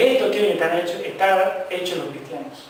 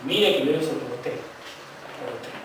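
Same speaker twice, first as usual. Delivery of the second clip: ordinary delivery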